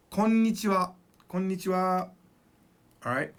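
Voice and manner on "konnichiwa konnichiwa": konnichiwa with a long held n; double n. Shown as a demonstration. Teacher giving the correct greeting, konnichiwa with doubled n. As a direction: dwell on the n's of konnichiwa. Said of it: "So it's".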